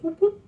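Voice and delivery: two high whoops; imitated toy whoops. Describing the colorful toy's motion, high imitated whoops. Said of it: "toy that goes".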